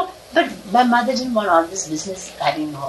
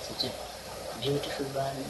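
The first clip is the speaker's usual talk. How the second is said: much softer, a hushed murmur; softly spoken; 5 semitones lower, 13 dB quieter than usual. Retold or imitated. Babajan murmuring to the sky, heard faintly. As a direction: murmur it gently as if overheard. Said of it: "She spoke very softly".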